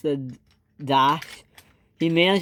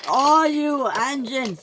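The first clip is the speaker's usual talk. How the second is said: slow, high, drawn-out cry; exasperated cry; 9 semitones higher, much slower than usual. A narrator's reading. Percy in a shoving match, exasperated cry. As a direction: wail it in annoyance.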